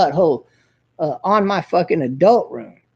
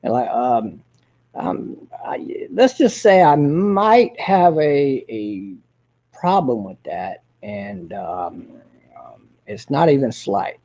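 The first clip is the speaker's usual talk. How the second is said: much lower, slow halting mumble; imitating Harry; 6 semitones lower, slower than usual. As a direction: drag it out low with long ums. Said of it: "He talks like this".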